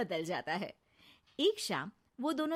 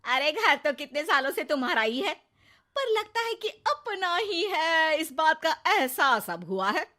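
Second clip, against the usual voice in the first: sarcastic wife; 7 semitones higher, 7 dB louder than usual. Acted. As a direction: needle them about coming home. Neha's sarcasm to husband and son. (ज़ोरदार व्यंग्य)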